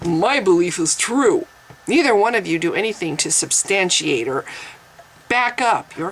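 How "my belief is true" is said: mock chuckle, then a smug declaration; put-on arrogant voice; arrogant believer. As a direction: imitate a self-satisfied believer. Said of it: "Just as arrogant as this guy".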